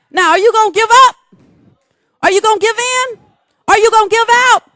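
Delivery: high, loud, fast preaching cry; rousing challenge. Real, not acted. Preacher challenging the congregation to hold on, rousing. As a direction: hurl the questions at the crowd.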